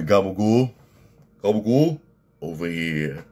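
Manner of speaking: comic New Jersey mobster voice; Silvio Dante. Retold or imitated. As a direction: do a hammed-up Sopranos wiseguy. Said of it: "Silvio Dante".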